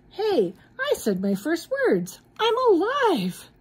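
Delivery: thrilled to be alive; excited. Acted. Frosty discovering he is alive, excited high cry. Snowman comes to life.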